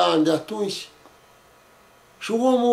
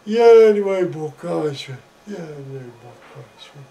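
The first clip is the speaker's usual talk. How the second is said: fading mock wail; 4 semitones lower, 4 dB louder than usual. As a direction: cry out, then fade as if going off. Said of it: "plângând să ne iei niște bocanși".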